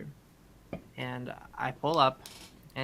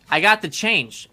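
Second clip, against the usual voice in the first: insistent call; 6 semitones higher, 10 dB louder, slower than usual. Retold or imitated. Calling the worker back.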